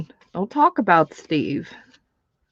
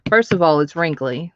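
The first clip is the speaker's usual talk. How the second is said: ordinary talk, nothing marked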